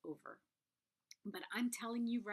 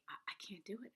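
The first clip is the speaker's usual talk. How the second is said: whispered despair; 8 dB quieter, much faster than usual. Retold or imitated. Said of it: "And I was like".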